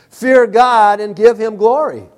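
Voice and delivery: high, loud proclamation; loud angel's voice. Acted. Preacher quoting angel proclaiming eternal gospel, loud voice. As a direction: proclaim it out over everyone. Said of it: "said with a loud voice".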